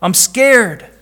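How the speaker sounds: put-on boy trapped by the smoke crying out; frightened boy. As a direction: plead like a terrified little boy. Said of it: "The boy cried".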